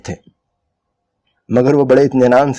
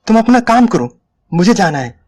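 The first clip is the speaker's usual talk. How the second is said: curt and irritated; 10 semitones higher than usual. A narrator's reading. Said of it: "औरत अब करीब करीब जाग पड़ी ... कहने लगी".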